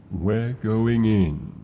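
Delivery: loud, slow battle cry; rally your rider as you dive; loud and slow, relishing the plunge; daring dive call. Acted. Dragon dives toward the isle.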